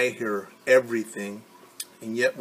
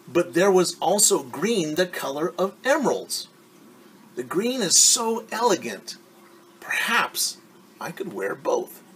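put-on higher girlish voice, fading at the end; a vain girl tempted by more; Ari admiring; 7 semitones higher, 3 dB louder than usual. Ari admiring the green silk.

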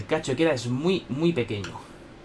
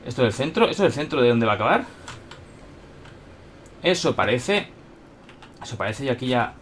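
ordinary delivery

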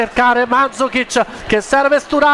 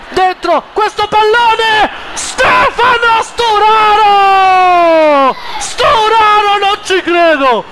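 breathless italian goal commentary; 4 semitones higher than usual. Acted. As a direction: explode with joy at the goal. Goal scored in FIFA commentary.

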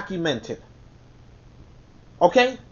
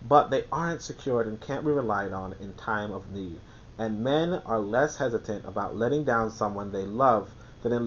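measured, lower reading voice; reading aloud; 7 semitones lower, 4 dB quieter than usual. Acted. Reading The Prince aloud to his audience, measured tone. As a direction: read the passage out steadily.

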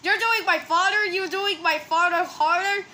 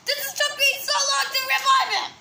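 upset outburst; 7 semitones higher, faster than usual. Acted. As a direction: wail about it in a rush. Acted outburst over a character dying.

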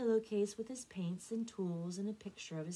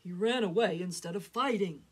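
bellowing; 9 dB louder than usual. A narrator's reading. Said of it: "Papa bellowed".

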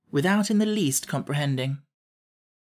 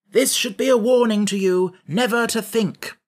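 higher, louder stern admonition; severe; 7 semitones higher, 5 dB louder than usual. A narrator's reading. Scarecrow rebuking Jack, severely. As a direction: lay down a firm warning. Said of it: "returned the Scarecrow severely".

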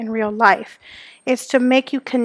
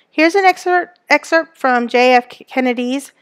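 ordinary talk

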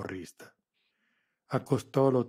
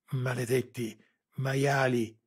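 panting curse; 3 semitones higher, 5 dB louder, slower than usual. A narrator's reading. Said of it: "ansimò Lara".

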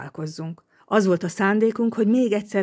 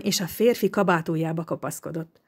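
usual speaking voice

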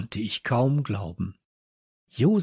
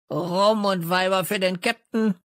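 much higher squawky parrot voice; croaking parrot; 7 semitones higher than usual. A narrator's reading. Cheeky parrot squawks about the captain, high croak. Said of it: "krächzte Störtebeker".

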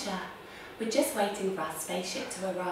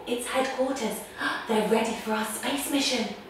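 normal talking voice

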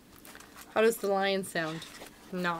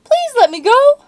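very high, loud squeaky plea; begging mouse; 15 dB louder than usual. Acted. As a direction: squeak and beg. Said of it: "Mouse begged".

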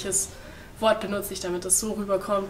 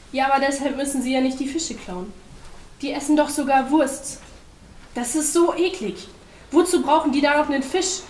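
raised, loud complaining; higher-pitched indignant objection; indignant protest; 6 semitones higher, 5 dB louder than usual. A narrator's reading. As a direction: argue back, appalled. Penguin protests in quarrel.

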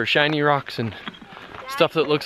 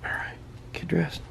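whispering; 13 dB quieter than usual. Real, not acted. Quiet morning, others sleeping.

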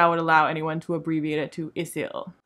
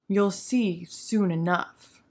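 slow mysterious Elendil; much slower than usual. Retold or imitated. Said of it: "And Elendil is like".

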